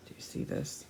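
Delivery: hushed aside whisper; soft secret question. Real, not acted. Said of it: "aside to children, whispered".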